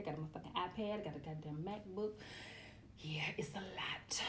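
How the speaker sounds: soft unvoiced whisper; whispered aside. Real, not acted.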